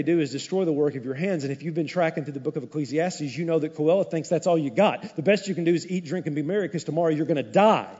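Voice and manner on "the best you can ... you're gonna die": normal talking voice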